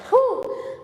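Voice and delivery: sharp high breathy "whew" after effort; exertion exhale. Real, not acted. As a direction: blow out hard, winded from the workout. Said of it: "I'm breathing hard".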